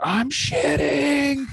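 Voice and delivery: put-on high, slow baby voice; toddler voice. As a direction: drawl it out like a toddler. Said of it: "The only words he knows".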